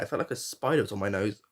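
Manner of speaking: ordinary delivery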